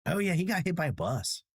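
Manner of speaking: high, casual claim; offhand rumor. Retold or imitated. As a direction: toss it off carelessly. Rumor starter voiced.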